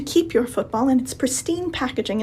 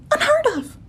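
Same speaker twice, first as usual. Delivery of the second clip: much higher mock-astonished quip; sarcastic. Real, not acted. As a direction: feign shock, dripping with sarcasm. Sarcastic about girls liking sports.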